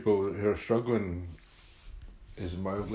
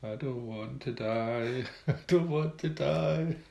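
slow, higher, laughing imitation of a wail; mock wailing; 6 semitones higher, slower than usual. Teasing his friend about being taken away, mock wailing. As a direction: wail it like a scared drunk, but teasing. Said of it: "you were just wailing".

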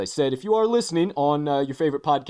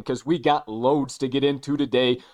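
normal talking voice

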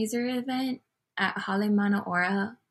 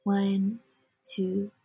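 measured meditation guide, long pauses between phrases; unhurried breath-counting cadence with lifted phrase endings; guided meditation. Real, not acted. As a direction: lead listeners through it in short, spaced phrases.

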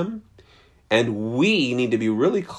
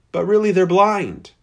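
louder, higher, pressing the word really; emphatic declaration; 8 semitones higher, 5 dB louder than usual. Real, not acted. Preacher to congregation, forceful emphatic declaration. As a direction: drive it home to the congregation.

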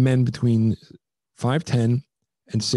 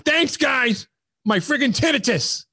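pitched-up mock cranky old man complaining; 11 semitones higher, slower than usual. Turning to the DJ booth, cranky old man sarcasm. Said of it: "like an old man".

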